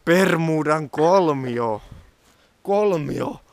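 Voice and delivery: drawn out slowly, relishing the name; slow mock-mysterious announcement; slow savouring. Real, not acted. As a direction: say "Bermudan kolmio" very slowly, stretching it.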